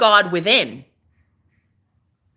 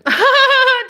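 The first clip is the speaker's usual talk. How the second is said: high piercing burst of laughter; shrieking laugh; 8 dB louder than usual. Real, not acted. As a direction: squeal with laughter. Laughing at her own joke.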